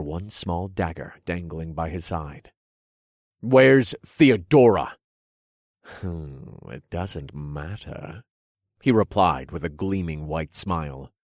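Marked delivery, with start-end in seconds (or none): 3.4-5.0 demanding shout. Acted. Hero demands answer. 5.8-8.2 slow, soft, mocking villain voice; sly villain king.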